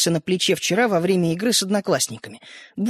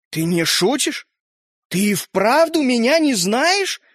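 slow, rising disbelief; a toy man stunned; astonished; 3 semitones higher, slower than usual. A narrator's reading. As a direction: toy man can't believe the boy doesn't know him. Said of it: "изумлённо произнёс человечек".

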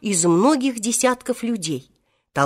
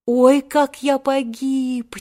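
slow, drawn-out lament; high, slow groan of doom; despairing stage whisper; 5 semitones higher, much slower than usual. A narrator's reading. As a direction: wail under your breath, stretching it out. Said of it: "тихо шептал Волька".

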